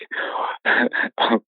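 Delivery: imitated heavy panting breath; hard breathing. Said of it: "breathing, like, really hard".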